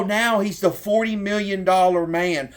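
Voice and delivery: ordinary talk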